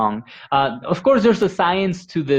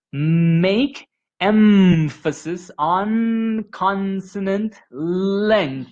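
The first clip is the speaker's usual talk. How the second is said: slow, stretched demo with every consonant held long; slow exaggerated stress; 6 semitones higher, much slower than usual. Shown as a demonstration. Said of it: "So read it".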